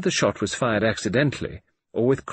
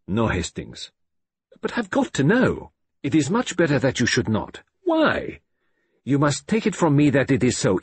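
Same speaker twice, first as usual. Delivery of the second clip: a hint of a firm old detective; calm, kindly refusal; lightly voiced Poirot; 5 semitones higher, 3 dB louder than usual. A narrator's reading. Poirot refuses to tell Hastings, mildly firm.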